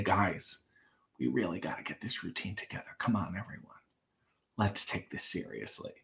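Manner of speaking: low, quiet, worn-out coach voice; a tired old coach rallying his squad; grizzled old cheerleading coach. Pretend. Pretend head coach addresses the Spirit Squad, low and weary. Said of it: "like an old grizzled cheerleader".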